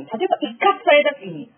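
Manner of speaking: ordinary delivery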